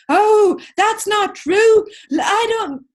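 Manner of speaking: high, loud, slow defensive retort. Retold or imitated. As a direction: snap back in mock protest. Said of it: "He didn't go".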